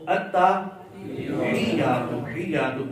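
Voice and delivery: very slow "التحیات", long pressed hiyy; teacher's stretched model of "التحیات"; correct pronunciation demo. Shown as a demonstration. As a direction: draw out "التحیات", then echo "حیات". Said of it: "مقصد یہ اصلاح کرنا".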